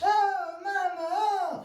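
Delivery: very high, slow, wailing complaint to Mama; whining son. Acted. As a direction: whimper it out like a beaten boy. Hooky complains again.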